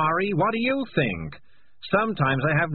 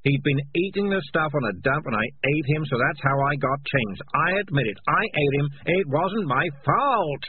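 agitated confession; 7 semitones higher than usual. Acted. Morris confesses to the rats he ate their friend, agitated and defensive. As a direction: blurt out the guilt, then push back.

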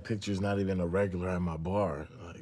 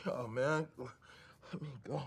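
defeated mutter; 6 semitones lower, 8 dB quieter, slower than usual. Acted. Suspect gives up, defeated.

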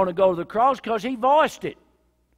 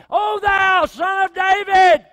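high, slow, all-out shout; full-voiced shout; 13 semitones higher, 13 dB louder, slower than usual. Blind man shouting over the crowd to Jesus, all-out. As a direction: bellow it to the heavens. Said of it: "thirty decibels up to about nine".

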